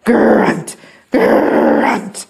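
growled, rasping grunts; monster grunt. A narrator's reading. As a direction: growl the word, drawing it out. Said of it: "It went grunt".

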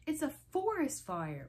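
high, stretched-out cry of alarm; screeching. A narrator's reading. Reader voicing a girl raising a fire alarm, screeching. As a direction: sound a hammed-up fire alarm. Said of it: "Liza screeched".

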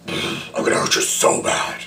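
raspy menacing whisper; Crack Fox deep alter ego. Retold or imitated. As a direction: switch to a sinister growl. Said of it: "weird little voice".

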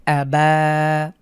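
"আবা" with the বা held long and slow; stretched vowel. Shown as a demonstration. Teacher demonstrates "আবা" drawn out. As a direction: drag out the final aa. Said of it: "এরপরে দেখুন".

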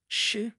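urgent "shh"; hushing. A narrator's reading. Tom warns his comrade in the graveyard, hushing. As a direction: hush a friend sharply. Boy hushes his friend in fear.

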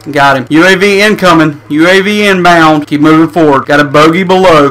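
usual speaking voice

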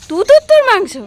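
high, loud delighted echo; excited question. Real, not acted. Mother echoes toddler's word, delighted.

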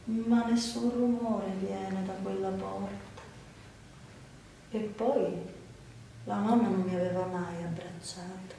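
soft, subdued inward narration; hushed sorrow. A narrator's reading. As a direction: confide it very quietly, with pauses. Narrated girl alone, shut out.

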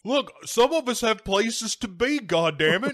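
high-pitched impatient driver rant; hammed-up road-rage griping; voicing displeasure. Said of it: "so he can voice his displeasure".

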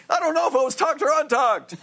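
high-pitched mock fretting; agitated patient. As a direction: voice leaps up in exaggerated confusion. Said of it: "and he goes".

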